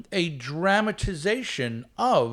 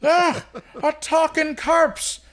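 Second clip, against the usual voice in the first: put-on Irish accent; 11 semitones higher, 4 dB louder than usual. Stingy Jack sasses a talking corpse in a hammed-up Irish accent. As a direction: ham up a cheeky stage Irishman. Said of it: "I'm gonna play Stingy Jack with my best, uh, possible Irish accent".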